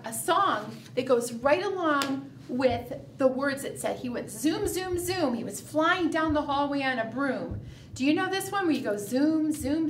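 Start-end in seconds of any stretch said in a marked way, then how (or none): none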